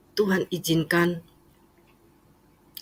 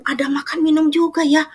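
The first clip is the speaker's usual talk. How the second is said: much higher, louder mock-mother delight; 10 semitones higher, 6 dB louder than usual. Mother praising church food, high and delighted. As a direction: gush like a mom pleased by the food.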